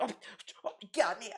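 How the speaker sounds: mock childish dismay, halting; childish upset. Streamer mimicking DSP as a child, mock dismay. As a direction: imitate a kid overreacting. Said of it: "this is how a child would act".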